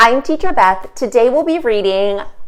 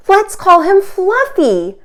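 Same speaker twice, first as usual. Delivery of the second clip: tender parents; 9 semitones higher, 4 dB louder, slower than usual. Acted. Proud parents naming baby.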